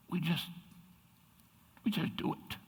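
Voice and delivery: ordinary delivery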